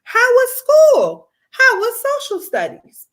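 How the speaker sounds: bright, high greeting from a loving father; lightly voiced cheerful poppy; cheerful dad voice. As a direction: call out hello to your little girl. Father greeting daughter after school.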